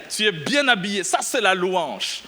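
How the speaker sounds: normal talking voice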